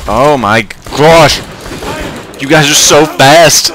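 loud, amazed outcry; excited shout. Real, not acted. Player swarmed by fast enemies shouts excitedly. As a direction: shout it out in alarm.

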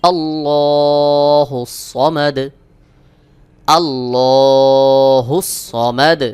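slow, melodic tilawat; correct recitation. Shown as a demonstration. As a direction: chant each phrase long and even. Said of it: "সহি উচ্চারণ হলো". (সহি সুরেলা তিলাওয়াত)

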